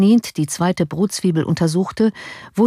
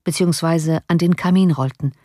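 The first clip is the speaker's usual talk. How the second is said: ordinary delivery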